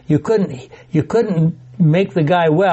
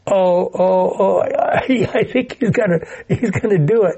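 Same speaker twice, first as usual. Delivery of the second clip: anxious onlooking Pharisees; 2 semitones higher than usual. Acted. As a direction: play flustered accusers watching it unfold. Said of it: "see the Pharisees going".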